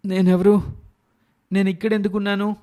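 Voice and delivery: waking up lost and confused; surprised. Acted. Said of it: "అని ఆశ్చర్యంగా అడుగుతాడు శంకర్".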